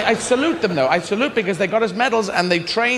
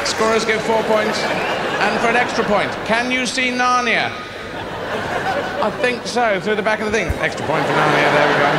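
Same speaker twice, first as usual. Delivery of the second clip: hushed-then-rising play-by-play; mock sports commentary. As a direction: parody equestrian commentator calling it live.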